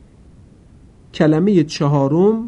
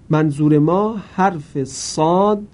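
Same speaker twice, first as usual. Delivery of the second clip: ordinary delivery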